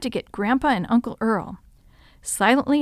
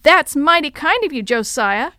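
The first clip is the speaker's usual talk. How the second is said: loud, higher-pitched sarcastic thanks; raised, steely challenge; cold and hard; 5 semitones higher, 7 dB louder than usual. A narrator's reading. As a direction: confront him with a hard edge. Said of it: "voice was as cold and hard as steel".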